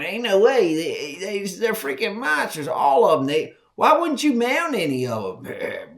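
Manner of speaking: loud and high, staring at monster racks; amazed outcry. Retold or imitated. Boy to the old hunter, loud and high in amazement. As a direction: yelp out your disbelief.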